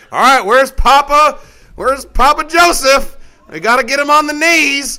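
hammed-up shout for grandpa to come; mock family call. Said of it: "It's like".

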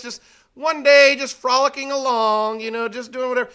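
high, lilting mock-innocent storyteller; mock fibber, frolicking along; breezy made-up story. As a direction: spin a carefree tall tale. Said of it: "gonna start out like this".

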